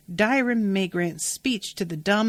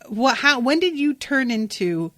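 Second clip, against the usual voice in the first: ordinary talk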